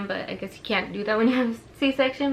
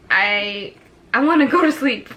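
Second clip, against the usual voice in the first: scared plea; 4 dB louder than usual. Retold or imitated. Scared before C-section, pleading.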